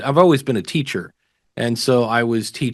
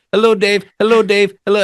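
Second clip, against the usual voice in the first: high, loud, foreign-sounding caller voice; Michael's voicemail greeting; 9 semitones higher, 7 dB louder than usual. Retold or imitated. Dave mimics the German fan's recorded hello, high and loud. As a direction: play the faraway fan leaving a friendly voice message. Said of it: "we just kept hitting play".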